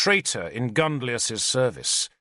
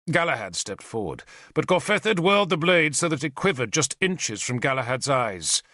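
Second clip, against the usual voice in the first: ordinary delivery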